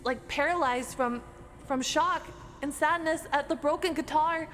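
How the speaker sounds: ordinary talk, nothing marked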